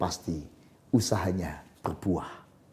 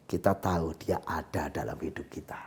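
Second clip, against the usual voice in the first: soft whisper; 6 semitones lower, 7 dB quieter than usual. Acted. Intimate closing after exhortation.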